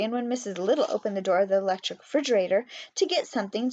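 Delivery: ordinary delivery, nothing marked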